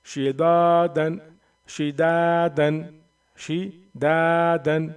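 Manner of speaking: "शिदादन" with a long, loud, held दा; long madd. Shown as a demonstration. Teacher showing how alif madd is read, दा in "शिदादन" stretched. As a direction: stretch the middle दा of "शिदादन", drawn out slowly. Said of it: "एक अलिफ के बराबर खींच कर पढ़ेंगे".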